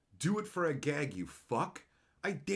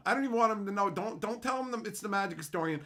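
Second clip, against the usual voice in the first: ordinary talk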